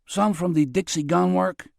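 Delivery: a hint of a Mexican accent, casual. Tonto answering Jerry, light Mexican accent. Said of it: "with an accent from down Mexico way".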